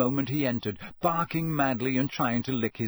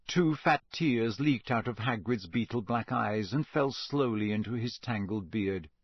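ordinary delivery

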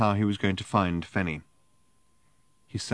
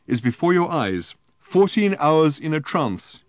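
put-on drunken storyteller; 9 semitones higher, 6 dB louder, slower than usual. Drunk Arthur spinning a tall tale.

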